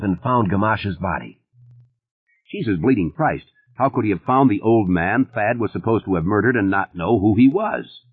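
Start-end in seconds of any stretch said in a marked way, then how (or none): none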